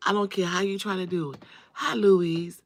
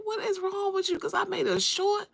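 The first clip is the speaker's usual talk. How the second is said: exasperated disbelief; 12 semitones higher than usual. Retold or imitated. Retold rant at harassing lady.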